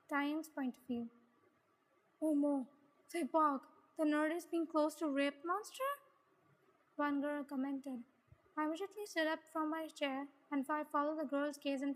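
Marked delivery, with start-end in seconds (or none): 2.2-5.9 loud, exclaimed gossip, rising at the end; scandalized classmate. Acted. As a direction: blurt it out in disbelief.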